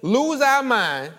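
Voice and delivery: loud, high, impassioned preacher's cry; fiery preaching with a laugh; full-force preaching. Acted. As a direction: preach it out at the top of your voice, laughing through it.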